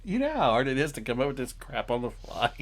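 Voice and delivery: ordinary talk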